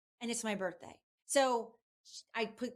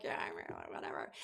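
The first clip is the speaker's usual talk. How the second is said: muttered aside; 10 dB quieter, much faster than usual. Retold or imitated. Her own muttered reaction.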